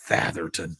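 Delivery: low, quiet naming of a rival; mock disdain. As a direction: drop the pitch, imitating a man spotting his enemy. Hank sees his rival, retold.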